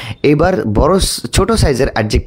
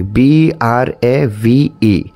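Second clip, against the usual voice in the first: slow spelling, each letter drawn out; measured, separated letters; much slower than usual. Shown as a demonstration. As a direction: count out the letters one at a time. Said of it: "letter count করি".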